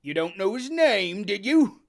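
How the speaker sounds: loud stern question; Silver pressing. Acted. Silver interrogates Morgan, loud and stern. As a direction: press him harder.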